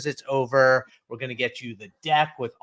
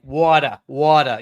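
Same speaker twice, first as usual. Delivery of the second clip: loud, put-on New Jersey "water"; exaggerated Jersey accent, rounded vowel, dropped R; 8 dB louder, slower than usual. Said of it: "I'm from Jersey originally, and so people expect me to say".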